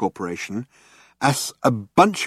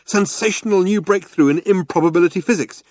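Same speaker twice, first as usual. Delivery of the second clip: brochure hype; 6 semitones higher than usual. A narrator's reading.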